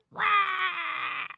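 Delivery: high, drawn-out mock roar; monster roar. Skin-eating alien appears, mock roar. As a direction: let out a cartoon creature cry. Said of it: "Skin-eating alien".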